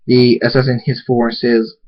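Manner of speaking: ordinary delivery, nothing marked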